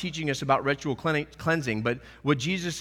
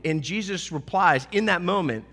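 ordinary talk

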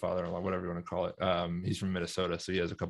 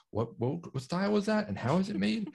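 confused; 11 semitones higher than usual. Retold or imitated. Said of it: "And I'm like".

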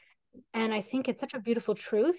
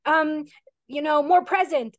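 pitched up, slow, preachy and scolding; mock judgmental voice; 7 semitones higher, 5 dB louder than usual. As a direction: parody a know-it-all telling her to be more present. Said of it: "it's not like".

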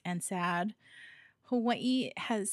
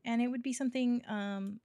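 ordinary talk

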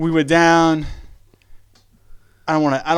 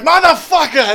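imitating a friend's outburst, higher; mock furious yell; 10 semitones higher than usual. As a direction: yell it like he's been poked once too often. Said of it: "and then it's like".

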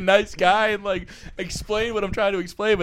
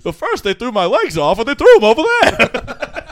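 loud, higher scarecrow impression; hammed-up movie scarecrow, raised voice; Wizard of Oz scarecrow; 4 semitones higher, 8 dB louder than usual. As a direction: imitate the scarecrow wailing about being torn apart. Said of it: "That's the scarecrow in the Wizard of Oz".

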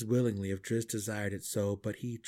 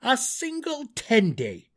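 loud, high, indignant gnome; indignant outburst that climbs higher; exasperated scolding; 7 semitones higher, 9 dB louder than usual. A narrator's reading. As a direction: hammer the limit home, voice raised.

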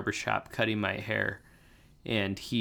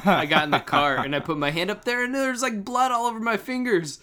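alarmed retelling; 9 semitones higher, 5 dB louder than usual. Real, not acted. Retelling finding blood on fingers.

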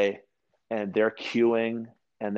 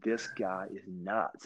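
much softer, slow under-the-breath remark; muttered aside; 10 dB quieter, slower than usual. Retold or imitated. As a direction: say it to yourself, fed up. Muttering at the brutal workout.

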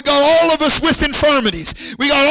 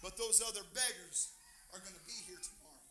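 barely audible, lowered voice; hushed appeal; 5 semitones lower, 30 dB quieter than usual. Real, not acted. Preacher appealing to the church about the other beggars, hushed. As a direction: urge them very softly.

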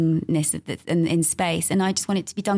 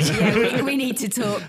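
laughing; 5 semitones higher than usual. Real, not acted. Joking amid laughter.

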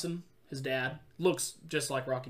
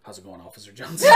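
much lower, fast, laughing aside; low knowing conspirator; laughing low and quick, as if sharing a secret; sly principal; 9 semitones lower, 11 dB louder, faster than usual. Retold or imitated. Principal's mock wink.

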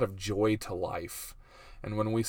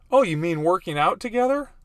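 high, louder questioning voice; wife's voice; 7 semitones higher, 6 dB louder than usual. Acted. Husband mimicking his wife's question, high put-on voice. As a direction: imitate a wife brightly catching on. Acting out wife's eager misunderstanding.

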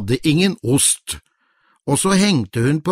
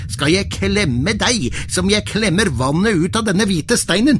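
threatening shout; 6 semitones higher than usual. Acted. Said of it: "skrek han til trollet".

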